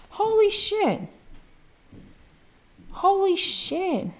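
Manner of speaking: high, breathless holy shit in real shock; stunned disbelief. Real, not acted. Streamer stunned by viewers' gifts, real amazed disbelief. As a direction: gasp it out, can't believe it.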